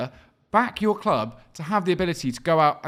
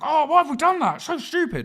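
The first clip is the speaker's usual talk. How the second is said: high, whiny fan voice; mock whiny fan; 9 semitones higher, 3 dB louder than usual. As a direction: moan it like a regretful fan. Mocking fans regretting spending.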